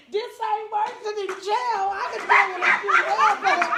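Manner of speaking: high, loud laughing self-talk. Retold or imitated. Speaker to himself about bed bugs, laughing loudly.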